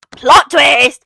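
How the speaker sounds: loud, high announcement; mock dramatic reveal. Reader revealing who the friend is, loud mock drama. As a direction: burst out with the big reveal.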